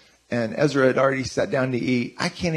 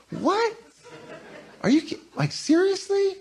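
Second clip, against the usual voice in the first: much higher, squeaky mock outrage; indignant disbelief; 4 dB quieter than usual. Husband mocking his indignant reply to wife, very high. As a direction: act out a squeaky indignant what, seriously. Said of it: "Chris is like, "Your tone".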